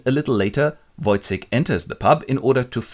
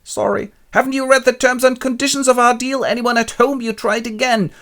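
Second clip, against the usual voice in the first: much higher, louder scolding quack; shrill mad doctor; 7 dB louder than usual. Retold or imitated. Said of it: "the doctor goes".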